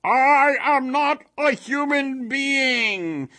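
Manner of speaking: bellowing golem, very slow and high; harsh monster roar. A narrator's reading. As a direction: stretch every word in frustrated protest. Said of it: "the stranger said loudly".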